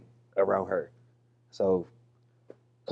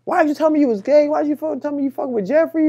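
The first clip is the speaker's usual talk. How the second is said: very high, fast mock mom voice; imitating his mom; 4 dB louder, faster than usual. Son mimics mom grilling him about Jeffrey. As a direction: rattle it off in a shrill mom squeal. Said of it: "it was just".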